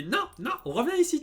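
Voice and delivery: high, loud mock stern call; imitating a very serious mother calling everyone back. Girlfriend's mother stopping them mid-way, mock stern high call. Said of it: "très sérieux".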